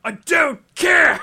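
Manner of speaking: high, slow furious yell; shouting. Pretend. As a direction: bellow it in mock rage. Crowe impression continues, shouting.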